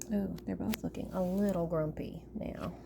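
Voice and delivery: ordinary delivery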